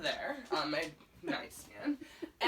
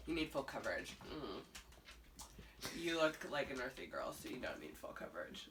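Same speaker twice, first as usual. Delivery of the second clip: low, quiet, dead-serious verdict; deadpan makeup expert; 9 semitones lower, 13 dB quieter, faster than usual. Retold or imitated. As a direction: pronounce it like a grave sommelier, almost breathless at the end. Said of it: "'Cause he was so serious".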